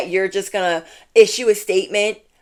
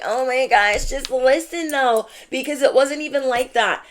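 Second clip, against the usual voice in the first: high-pitched mock pleading; mock crying; 6 semitones higher than usual. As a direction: imitate a tearful excuse, pitched up without real sobs. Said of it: "they're crying like".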